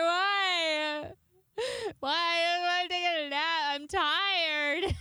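high, hammed-up crying whine; fake-sob like a tired toddler; dramatic mock wailing. Screaming at scheduler over five a.m. slot, mock crying. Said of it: "decided to be dramatic as fuck".

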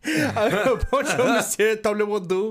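hammed-up French accent. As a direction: lay the French on thick, laughing. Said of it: "Oh, Pink Panther head ass".

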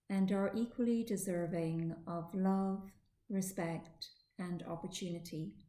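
slow meditation voice, long pauses; guided meditation. Acted. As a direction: ease the listener slowly into stillness. Leads guided relaxation.

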